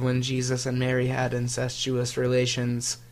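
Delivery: a hint of a polite phone assistant; lightly voiced polite robot; Siri voice. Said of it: "I talk in a robot voice".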